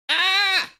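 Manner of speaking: very high, loud excited shriek; delighted shriek. A narrator's reading. Tally shrieking with relief after Harry leaves. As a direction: let out a thrilled shriek. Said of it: "she shrieked".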